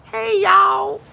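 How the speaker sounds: very high, loud Southern holler; hollered Southern greeting. Shown as a demonstration. Streamer showing viewers a down south greeting, hollered Southern. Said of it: "We say".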